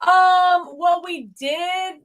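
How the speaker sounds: high, slow, flustered hedging; hesitant. Retold or imitated. Guest quoted dodging a nosy question, flustered. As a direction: stall like you've been caught out. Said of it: "and she was like".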